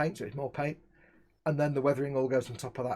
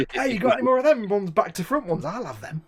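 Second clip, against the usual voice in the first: mock eager customer; 8 semitones higher, 7 dB louder than usual. Joking about customers wanting misprints.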